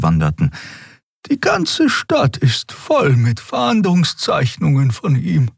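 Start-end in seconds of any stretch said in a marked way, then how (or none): 1.3-5.5 high, slow character voice; Xea musing aloud. A narrator's reading. Character puzzling over the wanted posters, musing aloud. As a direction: ponder it in high, drawn-out words. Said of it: "überlegte Xea laut".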